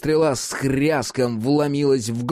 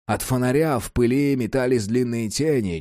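usual speaking voice

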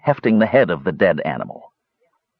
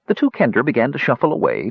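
ordinary delivery

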